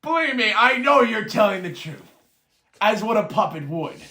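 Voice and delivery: high, loud mocking sneer, slowing at the end; dwarf mocking Yarner before the guards, loud and high. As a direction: cackle down at the puppet. Dwarf keeps taunting Yarner.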